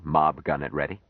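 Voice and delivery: ordinary delivery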